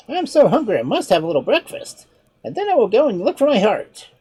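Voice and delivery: clever monkey. Acted. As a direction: play the monkey, a touch mocking. Monkey speaks.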